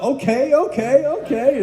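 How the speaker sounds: high rising okay okay okay; amused okay. Retold or imitated. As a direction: back down with a grin. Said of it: "and he's like".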